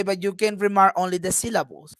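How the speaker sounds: normal talking voice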